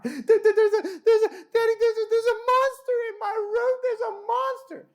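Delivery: put-on high, panicked child voice stumbling over words; a terrified small daughter calling for Daddy at night; frightened little girl. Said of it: "She's screaming, crying".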